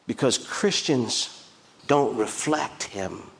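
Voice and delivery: ordinary delivery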